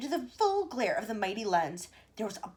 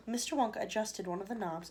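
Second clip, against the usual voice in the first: plain reading voice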